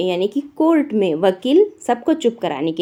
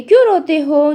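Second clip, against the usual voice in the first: higher, louder shouted question; 8 semitones higher, 7 dB louder than usual. Acted. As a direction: shout it at the crying kids. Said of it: "चिल्लाकर वो पूछती है".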